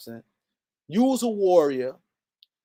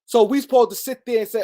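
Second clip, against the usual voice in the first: ordinary delivery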